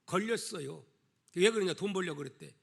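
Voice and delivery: normal talking voice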